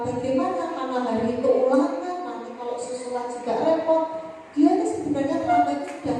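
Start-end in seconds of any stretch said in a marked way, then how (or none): none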